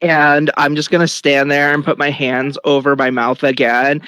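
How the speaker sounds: ordinary talk, nothing marked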